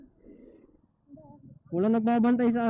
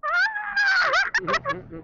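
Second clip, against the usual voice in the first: high laugh. Real, not acted. Laughing at being filmed.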